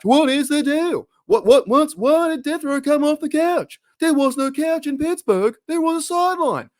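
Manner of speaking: sudden high-pitched comic outrage; incredulous stand-up squawk; Jerry Seinfeld. Acted. Host riffing on a player off the couch as a high, exasperated comedian. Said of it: "trying to do my Jerry Seinfeld impression".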